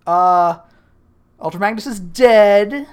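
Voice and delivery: loud call into the void. Retold or imitated. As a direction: shout out to the unseen guardians. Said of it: "he's just like".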